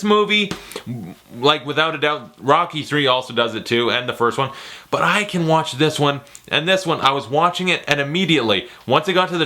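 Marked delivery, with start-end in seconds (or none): none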